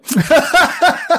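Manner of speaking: high burst of real laughter; big laugh. Real, not acted. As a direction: crack up laughing at the punchline. Laughing at his own joke.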